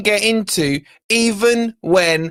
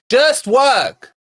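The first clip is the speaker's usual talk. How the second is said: loud, furious yell at the device; angry shout; 8 semitones higher, 4 dB louder than usual. Real, not acted. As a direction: snap and shout it out in rage. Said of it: "I'm so angry".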